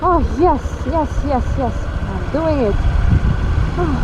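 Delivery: muffled voice in a helmet; talking inside a helmet on the bike; inside a motorcycle helmet. Real, not acted. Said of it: "You can hear me in, in the helmet".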